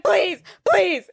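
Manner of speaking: high, loud begging; mock desperate pleading. Woman begs old friend for help, mock desperate pleading. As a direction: imitate frantic begging, pushing each word.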